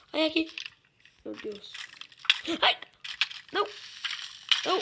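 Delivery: jumping at a sudden scare; startled shout. Real, not acted. (grito de susto agudo)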